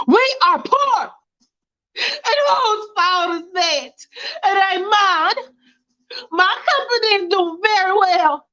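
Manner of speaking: wife blaming husband, slow and tearful; weepy wife. Acted. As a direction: fight back while crying.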